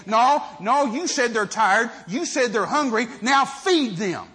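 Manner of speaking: loud, insistent command, slowing on the last words; a master calling a bluff, loud and deliberate; firm command. Retold or imitated. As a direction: push him hard, then lean on feed them. Jesus pressing Philip.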